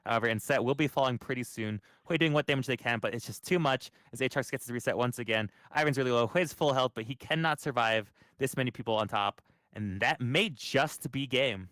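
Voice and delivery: loud, driven esports cast; live play-by-play. Acted. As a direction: call the teamfight as it happens. Casting the teamfight live.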